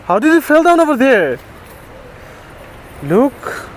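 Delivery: high-pitched, distressed disbelief; upset, voice pitched well up; shocked dismay. Real, not acted. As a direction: ask it in real alarm. Dismayed at the dog's fall.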